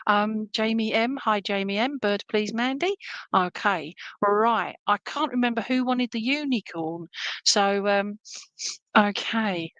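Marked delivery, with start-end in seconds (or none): none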